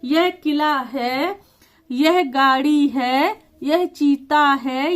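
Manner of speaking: words laid out very slowly, one by one; slow dictation. Shown as a demonstration. Teacher reading worksheet sentences aloud, slowly. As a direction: read each sentence as if a child copies it. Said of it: "बच्चे को वाक्य बनवाएंगे".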